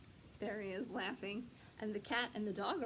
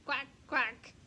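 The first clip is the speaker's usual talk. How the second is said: loud quacking duck voice; duck quacks; 4 semitones higher, 7 dB louder than usual. Acted. Children's song, loud quacking duck.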